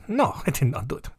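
soft mock Russian accent; comic suspect denying it in Russian accent, with a giggle; quiet, hammed-up Russian-accented innocence; Russian-accented denial. Acted suspect denying to Russian police.

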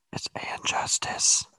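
hushed secret whisper. Real, not acted. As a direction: whisper it so nobody hears. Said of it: "I have to whisper it so that nobody else hears".

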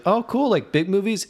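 high-pitched movie-heroine impression; mock impressed reply; imitated impressed woman. Acting the actress's impressed reply.

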